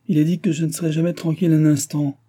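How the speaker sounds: a touch of peevish complaint; mild irritated grumble; grumbling. A narrator's reading. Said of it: "gronda le roi".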